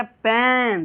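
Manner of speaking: "पेन" stretched on the vowel; long sound. Shown as a demonstration. Teacher illustrates the long sound, drawn-out "पेन". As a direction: draw out the middle of "पेन". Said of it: "long sound हो गया".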